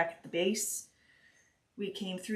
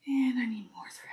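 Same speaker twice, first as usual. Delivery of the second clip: whispering; 5 semitones higher, faster than usual. Real, not acted. Quiet aside while working.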